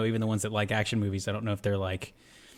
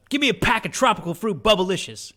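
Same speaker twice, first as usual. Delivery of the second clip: high, loud tough-guy demand; quoting Will Smith; 9 semitones higher, 7 dB louder than usual. Retold or imitated. Friend reciting a favorite movie line, high loud demand. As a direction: bark the candy order like a robber. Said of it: "I have that in my quotes".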